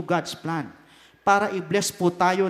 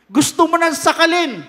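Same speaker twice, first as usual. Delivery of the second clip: mock rage; 7 semitones higher, 7 dB louder than usual. Acting urge to strangle someone.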